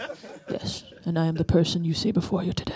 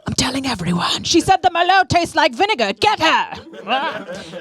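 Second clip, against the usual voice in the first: mock snob tattling; 10 semitones higher than usual. Snob announces the Bronx intruder with a high mocking cry. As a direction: shriek it out to the whole room. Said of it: "somebody would ... go".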